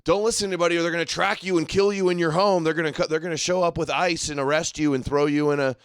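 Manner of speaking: mock alarmist warning. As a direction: rattle off a scaremongering warning in mockery. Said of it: "demoralizing, too. It's like".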